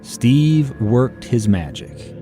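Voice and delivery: ordinary talk, nothing marked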